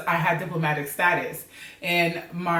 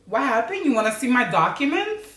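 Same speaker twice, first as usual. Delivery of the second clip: higher-pitched, mock-indignant questioning; rude; 7 semitones higher than usual. Rehearsing answers to a police officer, playing rude. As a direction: give the officer pretend attitude. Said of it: "like, rude with it".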